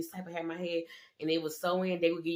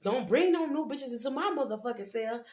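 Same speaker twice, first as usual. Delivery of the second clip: mock scream; 7 semitones higher than usual. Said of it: "the lady gonna scream out".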